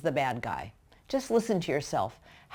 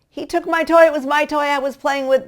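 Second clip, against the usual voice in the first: put-on much higher, louder whiny child complaint; upset child; 10 semitones higher, 10 dB louder than usual. Child complains to parent that his toy was taken, whiny. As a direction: whine like a wronged little kid. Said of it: "You say to one child".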